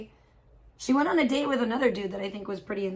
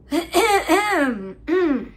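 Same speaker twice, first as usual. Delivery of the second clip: shocked disgusted groan; 6 semitones higher, 6 dB louder than usual. Real, not acted. Disgusted reaction to chat news.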